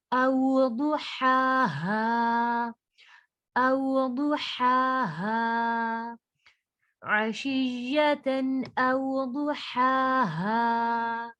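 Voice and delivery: slow, drawn-out tajweed recitation; Quran recitation. Shown as a demonstration. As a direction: recite it with ghunna on the noon. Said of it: "हा को हमने खींचना है". (धीमी तजवीद वाली तिलावत)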